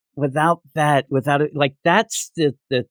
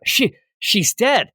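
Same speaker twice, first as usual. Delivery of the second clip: acted quote; 10 semitones higher, slower than usual. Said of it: "he says".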